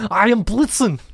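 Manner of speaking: mock German accent. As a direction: announce yourself in a proud German accent. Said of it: "Sounds German".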